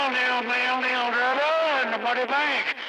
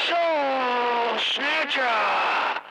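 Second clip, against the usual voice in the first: drawn-out call; much slower than usual. Acted. Greeting friend on the air.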